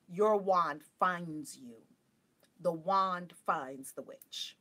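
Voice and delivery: slow, weighty, deliberate delivery; solemn pronouncement. Real, not acted. Stating the witch's rule to viewers, slow and emphatic. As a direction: let each word land like a maxim.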